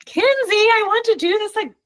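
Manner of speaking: bubbling excitement at a viewer's comment; excited. Real, not acted. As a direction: read it like it made your night. Reading an excited comment aloud.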